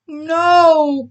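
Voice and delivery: high, loud tearful no; crying. Acted. Bully answering Oakley, high tearful cry. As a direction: whimper it out in pain. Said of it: "Bully cried".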